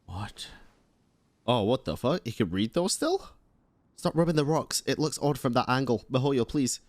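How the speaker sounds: ordinary talk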